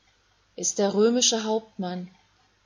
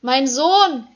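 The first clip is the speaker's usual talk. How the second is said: loud, higher calling out; Mary calling out to her son; anguished cry; 6 semitones higher, 12 dB louder than usual. Acted. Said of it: "ruft Maria".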